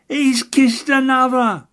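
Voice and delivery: delighted scream. Acted. The agent cheering on his candidate's baby-kissing, ecstatic scream. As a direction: squeal it out over the roar of the crowd. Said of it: "screamed the little gentleman".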